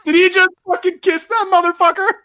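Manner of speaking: high, loud, squealed disbelief; incredulous outburst. Retold or imitated. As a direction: blurt it out through held-back hilarity. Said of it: "all you hear is these two grown".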